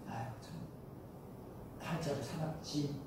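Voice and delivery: put-on old folks' sigh. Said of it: "옛날 어른들 그런 말을 해요".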